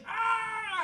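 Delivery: high shouted scream; a very high panicked shout; pilot's scream. Acted. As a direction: yell as the blast hits you. Pilot hit by explosion.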